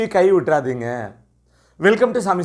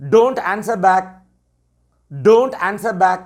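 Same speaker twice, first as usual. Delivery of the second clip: slow teacher model phrase; much slower than usual. Shown as a demonstration. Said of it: "எப்படி சொல்லலாம்?".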